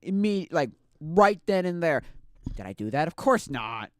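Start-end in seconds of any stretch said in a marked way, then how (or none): 0.0-2.0 much higher and louder, slowing as it builds; fired-up resolve. Retold or imitated. Vowing to herself she'll fix it, loud and high. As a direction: bellow the big plan you swore to yourself. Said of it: "'cause I said to myself".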